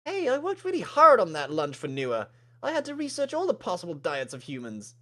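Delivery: high, indignant character voice; high-voiced offended friend; hurt protest. Maddie defends her cooking.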